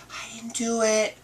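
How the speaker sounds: put-on high, small childish denial; little girl voice. Niece insisting she didn't do it, high childlike. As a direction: squeak it like a guilty four-year-old.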